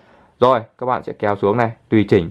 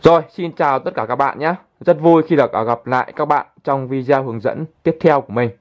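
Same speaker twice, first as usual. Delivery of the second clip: upbeat channel greeting; 4 semitones higher than usual. Shown as a demonstration. Tutorial host recording a test voice-over greeting for viewers, upbeat announcer read. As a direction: announce it like a YouTube host opening a video. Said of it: "mình là sẽ thử cho các bạn nhá".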